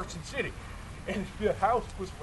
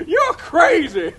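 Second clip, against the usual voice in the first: laughing retort; 6 semitones higher, 8 dB louder, much slower than usual. Retold or imitated. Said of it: "I was like".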